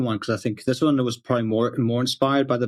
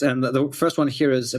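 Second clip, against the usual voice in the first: normal talking voice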